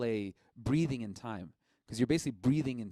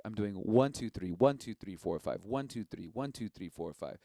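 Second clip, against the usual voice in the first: counting the three five block, fading softer and lower; counting the rhythm; 3 semitones lower, 4 dB quieter than usual. Shown as a demonstration. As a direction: count it out, letting it sink away. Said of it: "this is a three five rhythm block".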